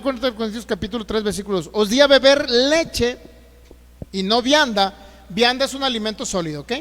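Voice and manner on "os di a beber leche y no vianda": slow, loud reading of the verse; forceful scripture reading. Real, not acted. Preacher reading the verse to his church, slow and loud. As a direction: proclaim each word to the room.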